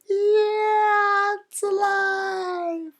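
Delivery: very high, long-held shriek of terror; hammed-up high-pitched panic; comic scream. A narrator's reading. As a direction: scream it, stretching every syllable. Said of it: "And the man screamed".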